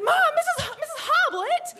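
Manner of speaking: put-on very high, loud teenage protest; panicked retold squeal; shrieked protest. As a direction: cry out in outrage, correcting yourself mid-plea. Protesting her mother's detention sentence.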